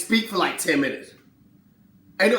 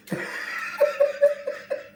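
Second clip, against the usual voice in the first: high cry-laugh. Real, not acted. Laughing at his own bit.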